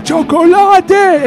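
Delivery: drawn-out, high, loud "chocolate"; Goonies Sloth imitation. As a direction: stretch it like Sloth. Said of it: "el que decía".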